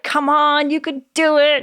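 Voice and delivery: high, loud cheering coach; rally a runner in the last mile; pumped-up coach urging you on. Retold or imitated. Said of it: "a tone that's very coaching".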